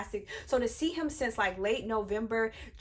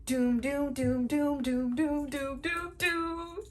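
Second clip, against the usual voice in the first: imitated entrance music; 2 semitones higher, much slower than usual. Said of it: "you hear it".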